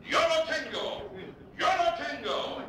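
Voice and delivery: high, loud, slow call for the ball; charging in and shouting over the shortstop. Retold or imitated. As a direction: yell to claim the catch. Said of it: "he starts shouting".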